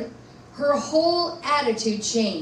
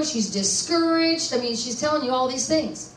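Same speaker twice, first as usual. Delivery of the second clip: ordinary delivery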